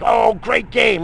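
loud, slow, high sarcastic "great game"; sarcastic mock cheer. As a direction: sneer it like a smug fan. Mocking fan's sarcastic cheer.

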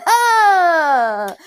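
loud, laughing cry of getting it; sudden realization. Retold or imitated. Viewer suddenly understands the show, laughing exclamation. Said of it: "Y yo así de".

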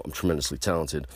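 ordinary talk

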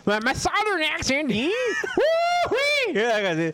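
hammed-up Southern American accent on request; playful fake Southern US accent. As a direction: show off a mock Southern US drawl with a whoop. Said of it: "¿Puedes hacer un acento southern?".

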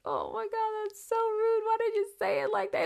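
squeaky high voice scolding herself; high mock self-scolding. Scolding herself after being rude to the roommate, squeaky high mock voice. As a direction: shriek at yourself in mock horror. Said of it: "but I was just like".

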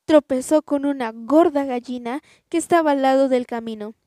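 plain reading voice

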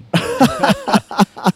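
big high burst of laughter; loud laughter. Real, not acted. Laughing at his own story.

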